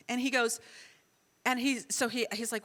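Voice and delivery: ordinary talk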